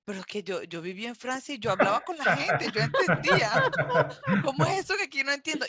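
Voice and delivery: retold bewilderment, rising into comic exasperation; baffled self-questioning. Newcomer to Quebec asking herself why she can't understand, comically baffled. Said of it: "y yo llegué a preguntarme".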